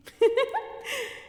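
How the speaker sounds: a sharp scornful laugh, high; scornful vampire laugh; mocking high laugh. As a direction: laugh down at him. Vampire mocks hunter.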